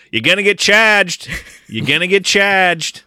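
hammed-up quote of a movie line, yelled; mock movie yell. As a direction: shout it like a batting-cage taunt. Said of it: "lines of dialogue in my notes".

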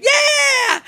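put-on high, drawn-out kid cheer; excited cheer. Kids cheering as show starts.

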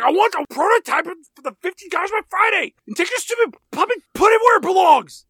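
high, fast, loud tyrant boss; hammed-up raging boss yell; mock furious shout. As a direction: scream the orders like a tantrum. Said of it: "David's like".

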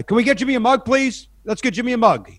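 loud, high call across the set. Retold or imitated. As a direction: shout it out to the crew like a director. Director calling out on set.

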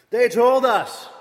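loud declaration; emphatic. Acted. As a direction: hit it hard and loud. Building indictment.

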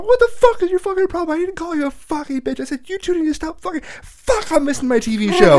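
very high, loud furious yell; angry shout. Retold or imitated. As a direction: yell back in a rage. Shouting back at sister.

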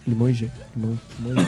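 confused muttering. Retold or imitated. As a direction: mumble it like a lost waiter. Confused waiter repeating order.

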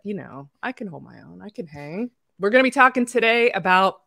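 usual speaking voice

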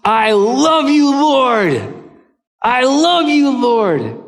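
loud, high, slow proclamation; fervent declaration. Acted. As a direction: cry it out to God with full devotion. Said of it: "the psalmist declares".

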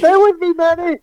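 begging a fallen friend to hold on; man down shout. Pretend. Joining the dying comrade bit.

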